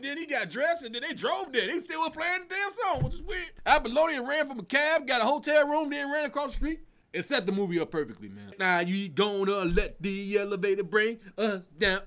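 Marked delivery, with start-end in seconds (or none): none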